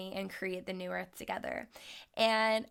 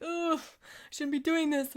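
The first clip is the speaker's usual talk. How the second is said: higher, mock-worried inner voice; inner doubt voice; 7 semitones higher than usual. Meditating woman voicing her guilty inner doubt, mock-worried. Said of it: "going like".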